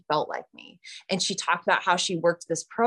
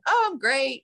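fake cheer; 7 semitones higher than usual. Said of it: "And I'm lying".

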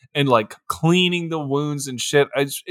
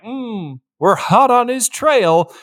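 higher, slow smug cop voice; mock cop; 6 semitones higher, slower than usual. Deputies boasting about the chase, higher and slow. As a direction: drag it out like a proud deputy.

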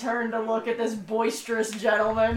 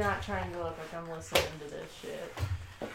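hushed, much softer aside; playing it chill; 6 semitones lower, 11 dB quieter than usual. Real, not acted. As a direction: keep it low and casual. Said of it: "I'm just playing chill".

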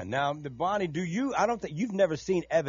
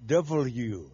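pencil in teeth; 3 semitones lower, 5 dB louder, much slower than usual. Shown as a demonstration. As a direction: talk with a pencil between teeth. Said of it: "put a pencil between your teeth".

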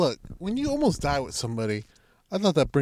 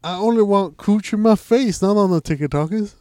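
put-on old prospector; 5 semitones higher, 5 dB louder than usual. Old man bit.

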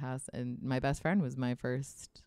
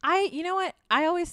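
excited; 8 dB louder than usual. Real, not acted. Sudden realisation, high burst.

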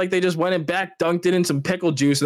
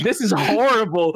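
higher, half-laughing mock complaint; retold disgusted outrage; 4 semitones higher than usual. Retelling the pickle complaint to friends, mock outrage. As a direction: act appalled while starting to laugh. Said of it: "I was so mad".

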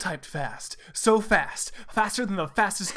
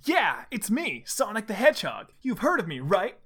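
plain reading voice

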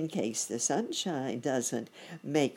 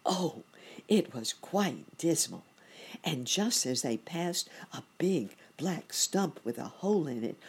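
usual speaking voice

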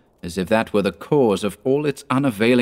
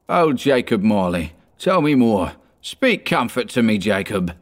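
plain reading voice

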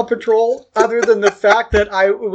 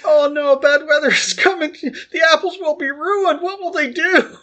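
much higher, breathless mock-dramatic alarm; mock cartoon worry; 9 semitones higher than usual. Groggy on meds, retelling the Paw Patrol plot to Nick, high mock alarm. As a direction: narrate the peril like a wide-eyed kid. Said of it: "It's like".

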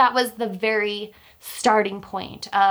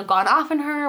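normal talking voice

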